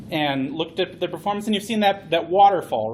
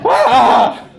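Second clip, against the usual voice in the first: loud hammed-up scream; a short comic shriek of fright; mock scream; 6 semitones higher, 11 dB louder than usual. Mock fright as ship lands.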